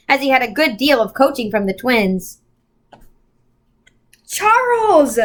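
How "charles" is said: high sharp scream of a name. A narrator's reading. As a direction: scream his name in alarm. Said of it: "Meg screamed".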